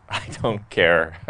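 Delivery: slow, dry, unbothered brush-off; sarcastic. Retold or imitated. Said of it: "super sarcastic".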